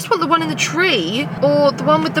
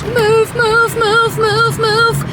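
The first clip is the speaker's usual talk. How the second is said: mock urgent command; 10 semitones higher, much slower than usual. Urging people out of way.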